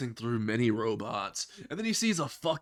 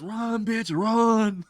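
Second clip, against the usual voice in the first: higher and louder, a hammed-up shout; comic rescue yell, pitched up and loud; yelling run; 9 semitones higher, 5 dB louder, much slower than usual. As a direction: bark it at her to get moving. Izuku yelling at Uraka to flee.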